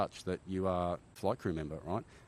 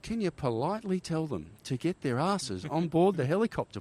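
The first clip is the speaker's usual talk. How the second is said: high, louder sarcastic request; exasperated mock politeness; 8 semitones higher, 5 dB louder than usual. Pilot ordering troops aboard under fire, sarcastically polite. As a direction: push it up high and make the politeness biting.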